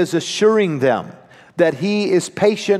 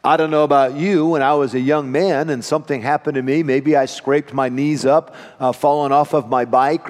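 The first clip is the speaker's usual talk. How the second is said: usual speaking voice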